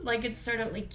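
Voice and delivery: normal talking voice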